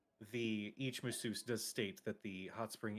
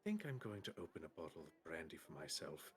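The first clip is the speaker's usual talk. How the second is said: resigned construct butler; 5 semitones lower, 11 dB quieter, faster than usual. Acted. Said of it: "And Jules says".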